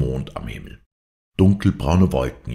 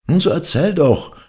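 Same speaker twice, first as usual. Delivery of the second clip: high, loud maid's urging; urgent calling out; 14 semitones higher, 6 dB louder than usual. A narrator's reading. Maids urge Angrete to tell, high loud call. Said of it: "riefen die Dirnen wieder".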